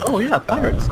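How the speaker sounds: high, eager agreement; excited. Real, not acted. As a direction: cheer for the idea. Enthusiastic agreement.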